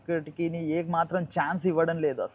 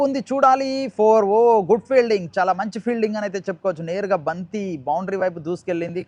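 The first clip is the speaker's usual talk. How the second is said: higher, louder burst of live commentary; excited cricket commentary; 3 semitones higher, 4 dB louder than usual. Real, not acted. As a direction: call the near-four with rising excitement. Live call of a boundary shot.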